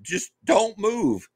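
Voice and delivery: high-pitched, insistent reenacted warning; urgent doctor's order. Doctor telling patient to stay still, high imitated urging. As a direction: urge a patient to stay still, slowing at the end. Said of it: "And the doctor say".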